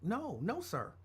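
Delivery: soft, mock-innocent denial; hushed, wide-eyed innocence; all innocent. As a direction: play the innocent kid, quiet and polite. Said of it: "me, I'm all innocent".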